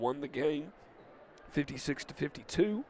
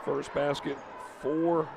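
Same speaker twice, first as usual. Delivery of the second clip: play-by-play call; 5 semitones higher than usual. Acted. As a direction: call the action to the crowd.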